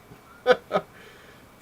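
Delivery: loud, high laugh; burst of laughter. Real, not acted. Comic fan laughing after a shocking scene, loud high laugh. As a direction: crack up at your own reaction. Laughs at his own reaction.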